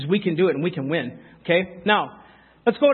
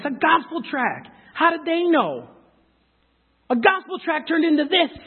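high-pitched, marveling emphasis; amazed; 8 semitones higher, slower than usual. Real, not acted. Preacher to congregation on a tract changing his life, amazed. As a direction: marvel at how much came from so little.

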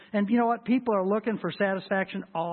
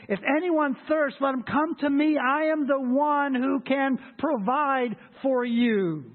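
loud call; 7 semitones higher, 4 dB louder, slower than usual. Acted. Preacher voices Jesus' call.

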